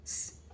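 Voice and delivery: स as a long soft hiss, no voice; a drawn-out hissing स. Shown as a demonstration. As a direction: let स hiss out slowly. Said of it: "S की sound होती है स".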